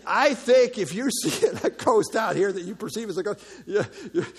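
talking through real laughter; laughing. Real, not acted. Preacher joking about rowers seeing a ghost, laughing. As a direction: break up laughing mid-sentence.

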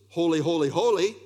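ringing "holy" stacked three times; threefold emphasis. Shown as a demonstration. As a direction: build the three "holy"s into a superlative. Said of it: "threefold emphasis".

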